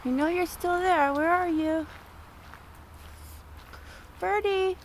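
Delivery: high, sing-song calling to a bird; coaxing a wild bird. Real, not acted. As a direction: sweetly call out to the hiding bird. Searching for the hidden bird.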